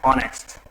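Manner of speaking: ऑनेस्ट said low and soft, starting on "o"; correct pronunciation demo. Shown as a demonstration. Teacher answering own question for students, ऑनेस्ट with silent h. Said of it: "क्या होगा?".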